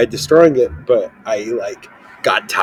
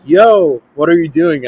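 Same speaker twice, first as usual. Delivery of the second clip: surprised; 5 semitones higher, 8 dB louder than usual. Retold or imitated. Classmates asking, retold.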